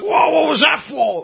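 high, slow mock outburst of a clueless armchair fan; high, drawn-out mock yell of outrage. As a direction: play the baffled fan who doesn't get the whistle. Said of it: "it's like".

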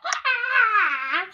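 high, loud shriek breaking into laughter; hammed-up scream; mock bloody-murder scream. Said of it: "like, screaming bloody murder".

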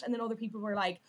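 ordinary talk

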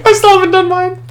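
high laugh through the words. Real, not acted. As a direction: laugh while talking. Laughing hard at friend.

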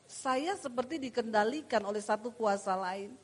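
usual speaking voice

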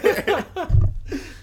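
loud, high-pitched laugh; burst of high laughter. Real, not acted. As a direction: crack up at the joke. Joke about comedy tour.

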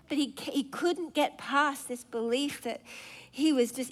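ordinary delivery

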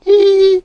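shrill mocking girl's laugh; squealed laugh; high giggle. A narrator's reading. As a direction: let out a short, high, jeering giggle. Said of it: "a girl said laughing".

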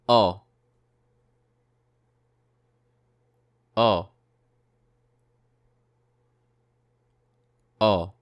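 O as a pure rounded vowel; model vowel sound. Shown as a demonstration. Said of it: "écoutez encore une fois".

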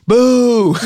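loud high heckling "Boo!"; heckler boo. Retold or imitated. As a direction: boo from the back like a planted heckler. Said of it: "he's like".

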